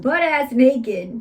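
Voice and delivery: ordinary delivery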